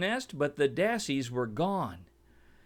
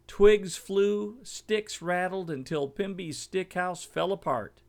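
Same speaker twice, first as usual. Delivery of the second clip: ordinary delivery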